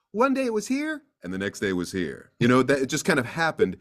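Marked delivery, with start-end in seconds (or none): 0.1-1.0 high pitched, lifted well above normal; voice placed high. Shown as a demonstration. Actor demos his voice before it changed, high. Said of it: "It was just kind of". 1.2-2.3 low and softer, sunk down; voice dropped low. Shown as a demonstration. Actor demos his voice after it changed, low. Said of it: "It was just kind of".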